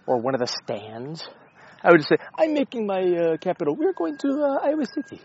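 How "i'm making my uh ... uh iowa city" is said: put-on high-pitched, nondescript evil foreign accent; vaguely Russian, evilish accent up high. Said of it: "I don't know what accent that is".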